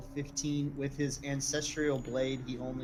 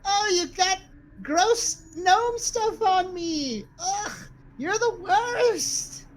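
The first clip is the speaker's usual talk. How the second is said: high, loud grossed-out wail; talking blade; 11 dB louder, much slower than usual. Acted. Blade whining at its owner about gnome gunk, loud disgusted squeal. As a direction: shriek it in drawn-out disgust. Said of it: "the blade's like".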